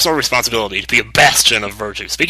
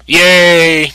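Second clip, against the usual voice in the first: much louder happy cheer; 7 semitones higher, 10 dB louder than usual. Real, not acted. A player celebrates the brothers making up, loud and bright. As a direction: whoop it out in delight.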